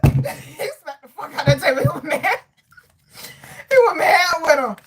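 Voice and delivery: talking through laughter; real laughter breaking up the words; laughing hard. Real, not acted. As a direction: tell it while cracking up. Cracking up retelling the scene.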